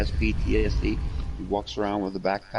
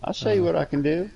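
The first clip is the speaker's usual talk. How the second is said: fast, high-pitched taunting challenge; re-enacted confrontation; 9 semitones higher than usual. Challenging a bully over a little kid, re-enacted confrontation. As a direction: dare the bully to pick on you.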